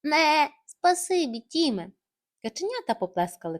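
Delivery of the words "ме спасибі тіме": slow bleating sheep shout, higher. Acted. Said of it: "закричала". (протяжне овече «Ме» з вигуком)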